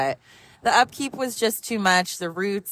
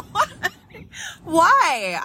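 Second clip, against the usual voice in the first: surprised why; 9 semitones higher than usual. Retold or imitated. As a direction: blurt it in disbelief, laughing. Mother stunned by breakup news.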